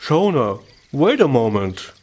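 hurried-sounding call, falling; quick interjection. A narrator's reading. Hedgehog stops the departing sheep, urgent. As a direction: call out to stop someone leaving. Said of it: "Iggory quickly said".

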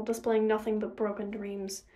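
plain reading voice

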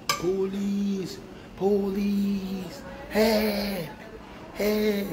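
high, held mock distress call; imitated cry for help. Retelling someone calling the police, mock cry for help. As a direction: call out long, level, high notes without shouting.

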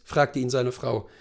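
plain reading voice

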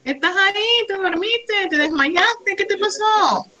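teacher calling a silent student; checking whether Vero is still there. Real, not acted.

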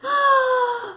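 loud high blowing puff; a big blow of breath; puffing blast. Shown as a demonstration. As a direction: puff with all your might.

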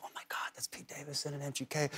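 a breathless whisper of a starstruck fan; excited whisper. Retold or imitated. A starstruck girl in a car whispers to her boyfriend. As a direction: whisper it like you don't want to be heard.

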